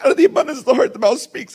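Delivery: high, loud mock wife voice, accusing; imitating the wife. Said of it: "And she's like".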